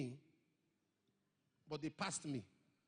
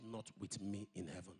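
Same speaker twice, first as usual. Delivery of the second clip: the Lord speaking; 10 semitones lower than usual. Retold or imitated.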